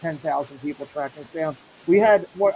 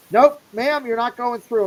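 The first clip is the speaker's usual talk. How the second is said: loud gatekeeper refusal; 6 semitones higher, 5 dB louder than usual. Retold or imitated. Retold gatekeeper blocking him.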